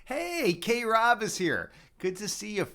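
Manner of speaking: high, long drawn-out hail; excited greeting. Real, not acted. Streamer spots an old friend in chat, excited greeting. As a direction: call it out slowly, thrilled to see a friend.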